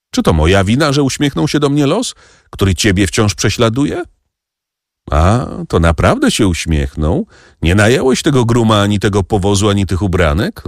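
plain reading voice

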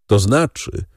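ordinary delivery

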